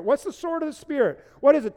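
ordinary delivery

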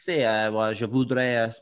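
put-on Spanish-accented French thank-you; clumsy Spanish speaker trying French. Joining the Rafa impression with a Spanish-accented speech. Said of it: "it's so Spanish".

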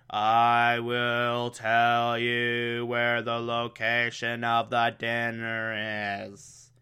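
slow, soft trance-like monotone; a hint of a hypnotized drone, slowed right down; brainwashed drone. Alfred under mind control, slow soft monotone. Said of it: "makes him say".

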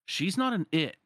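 indignant retort. Acted. Roxas snapping at Sayax to defend Shion, indignant. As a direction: defend her, offended.

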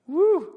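a sudden high, loud whoop of excitement; excited whoop. Real, not acted. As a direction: let out a big excited woo. Preacher exclaiming after bold claim.

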